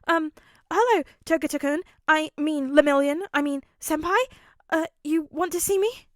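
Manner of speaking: high, flustered stammering; nervous kid tripping over titles; flustered boy voice. Acted. Flustered Midoriya.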